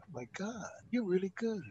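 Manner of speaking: hushed and impressed. Retold or imitated. Producers' impressed quote.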